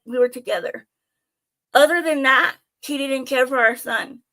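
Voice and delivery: usual speaking voice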